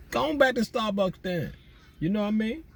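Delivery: high-pitched teasing jab; mock retort. Teasing Ashley for going back to Starbucks, mock retort. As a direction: tease her in a high, put-on voice.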